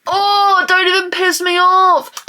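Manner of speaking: high, drawn-out annoyance; exasperated rant. Real, not acted. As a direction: whine it out in irritation. Ranting about others' money.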